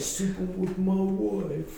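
mock posh British accent, slow and lifted. Friend mocking Bigfoot, in a slow lifted British accent. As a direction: put on a hammy British voice. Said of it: "Went real British with that".

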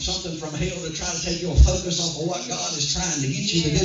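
driving, unbroken sermon delivery; forceful preaching. Real, not acted. Preacher warning the congregation hell will oppose them, forceful preaching. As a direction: preach it hard, phrase after phrase.

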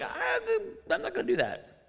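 high, slow, laughing promise. Retold or imitated. Preacher imagines obeying a snorting Jesus, laughing. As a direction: laugh it out, scared to disobey. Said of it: "you better believe, like".

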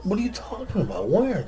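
high, startled disbelief; surprised guy. Retold or imitated. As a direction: squeak it out in surprise. Joke, guy's surprised reply.

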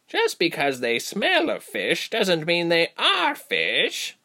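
high, slow, deliberate rebuke; female creature. Acted. Female creature defending captives to the pack, slow rebuke. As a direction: creature leader putting the pack straight.

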